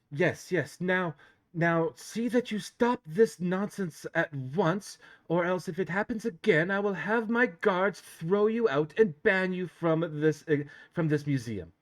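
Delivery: prim museum owner threatening; stern posh elf lady. Acted. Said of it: "it's like".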